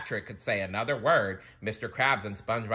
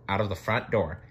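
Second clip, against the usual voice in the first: ordinary delivery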